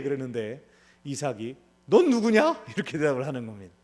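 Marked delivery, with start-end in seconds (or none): 1.9-2.6 loud startled demand, who are you; a father caught off guard, loud and startled; startled old father. Acted. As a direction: jump and bark the question. Isaac startled asks.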